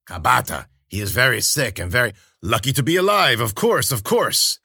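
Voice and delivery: hint of a stern old nurse; stern old crone. A narrator's reading. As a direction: scold him like a strict old healer.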